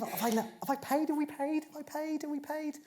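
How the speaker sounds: high, worried mock customer; acted nervous self-questioning, pitched high; anxious second-guessing. Acting out an unsure café customer.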